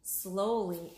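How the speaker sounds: usual speaking voice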